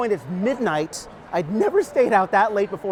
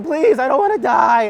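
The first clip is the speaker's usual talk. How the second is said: mock scream, higher and louder; retold scream; 6 semitones higher, 7 dB louder than usual. Kid pedaling away from punks, screaming in panic. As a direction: yell it while fleeing for your life.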